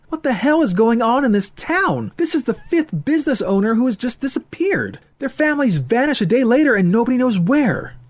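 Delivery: put-on high, loud, distressed young man; upset young man. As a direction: pour it out in rising anger and fear. Voices Ethan, upset.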